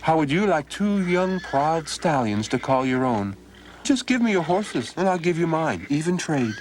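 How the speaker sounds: high, eager trickster's pitch; sly young Donald. Acted. Donald coaxing the farmer into a trade, high and eager. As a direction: sell the swap like a cheeky lad.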